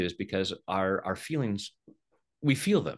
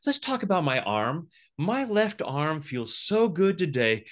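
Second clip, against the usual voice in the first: mock cheerful patient; 9 semitones higher, 4 dB louder than usual. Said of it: "you don't say to the doctor".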